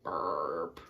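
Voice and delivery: low, drawn burp; deep silly burp; deep mock burp. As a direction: belch it out lowly. Fish burps after cake.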